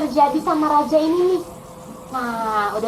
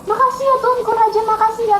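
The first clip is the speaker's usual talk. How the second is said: high, gushing gratitude; happy thanks; 6 semitones higher, faster than usual. Acted. Freed servant thanking the king on leaving, happy and high. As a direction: thank the king with lifted, joyful pitch. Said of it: "dia pulang dengan senang".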